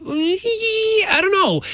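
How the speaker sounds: high, slow sing-song I don't know; drawn-out sing-song doubt; mock drawn-out shrug. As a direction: stretch it out way up high like a teasing shrug. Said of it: "To which I would say".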